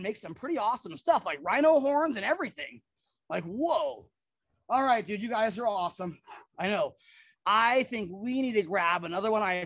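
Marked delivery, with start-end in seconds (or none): none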